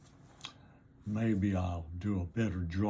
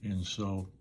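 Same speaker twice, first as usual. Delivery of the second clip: normal talking voice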